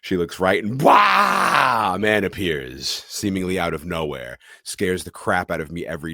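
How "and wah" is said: high, loud sudden wah; jump scare yell. Retold or imitated.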